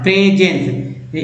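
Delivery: Presence slowly, as pre and jens; teacher sounding out presence in two parts; split into parts. Shown as a demonstration. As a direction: draw out both halves of presence. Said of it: "पीआरई को pray बोलते हैं".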